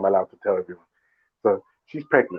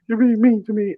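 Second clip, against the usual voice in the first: high-pitched whiny complaint; hammed-up weepy wail; mock crying; 10 semitones higher than usual. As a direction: imitate a tearful wife whining. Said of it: "she started to cry".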